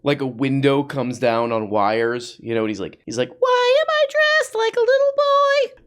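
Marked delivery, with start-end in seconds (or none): 3.4-5.8 very high squeaky cartoon voice, mocking; squeaky parody voice.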